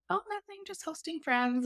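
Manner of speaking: very high, soft little fib; feigned innocence. Retold or imitated. Baker fibbing to the landlord, high feigned innocence. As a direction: play it sweetly evasive, all mock innocence.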